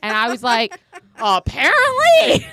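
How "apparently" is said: high, loud, drawn-out retort; exasperated outburst. Retold or imitated. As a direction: blurt it out in a hammed-up squeal. Her exasperated reply to the nurse.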